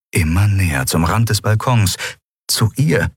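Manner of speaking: ordinary delivery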